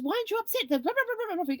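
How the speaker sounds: mimicking the husband's rant, squeaky high mockery. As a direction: parody his tirade in a squeaky voice. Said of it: "complete tirade".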